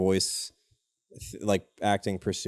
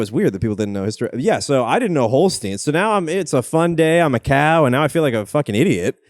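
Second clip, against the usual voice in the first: ordinary talk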